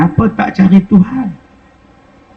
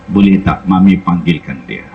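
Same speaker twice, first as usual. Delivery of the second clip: blind Kasim's voice; 13 semitones lower than usual. Retold or imitated. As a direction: speak low and gentle, asking who weeps. Blind Kasim asks about crying.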